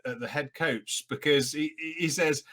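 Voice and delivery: ordinary talk, nothing marked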